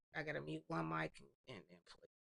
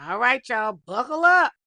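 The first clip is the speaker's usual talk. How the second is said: hype call; 4 semitones higher, 19 dB louder than usual. Real, not acted. Hypes viewers for story.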